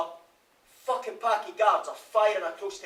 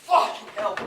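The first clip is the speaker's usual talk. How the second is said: sharp, loud curse, higher in pitch; angry outburst; 6 semitones higher, 7 dB louder than usual. Acted. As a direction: spit it out in anger. Alone, cursing after the death.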